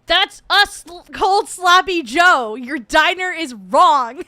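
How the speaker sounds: loud, high, drawn-out protest; outraged shout. Real, not acted. Streamer scolding a viewer's diner, outraged shout. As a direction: shout it in comic outrage, slowly.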